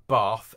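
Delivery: BATH with long, open back "ah"; RP vowel. Shown as a demonstration. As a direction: say BATH the southern RP way, "bahth". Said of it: "R P in sort of most of London".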